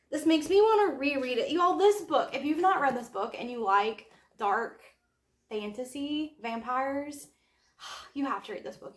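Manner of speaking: ordinary talk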